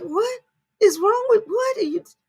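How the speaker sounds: mock outrage. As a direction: squeal in fake disbelief at the shoes. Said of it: "it's like".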